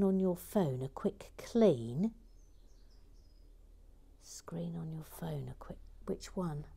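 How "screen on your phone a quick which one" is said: whispering. Real, not acted. Quiet muttering while cleaning phone.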